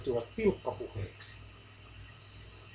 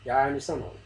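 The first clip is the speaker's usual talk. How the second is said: usual speaking voice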